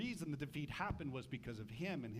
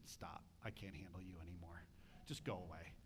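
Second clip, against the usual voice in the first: much softer, low and flat, waving whiners away; dismissive brush-off; 10 semitones lower, 10 dB quieter than usual. Retold or imitated. Pastor brushing off complaining people, soft and dismissive. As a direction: quietly shoo them off, done with it. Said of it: "shake my head and say".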